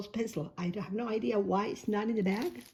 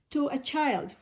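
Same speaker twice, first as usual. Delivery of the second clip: ordinary delivery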